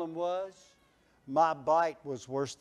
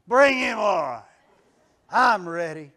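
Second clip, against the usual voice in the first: bowed up; 2 semitones higher, 11 dB louder than usual. Retold or imitated. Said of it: "I bowed up".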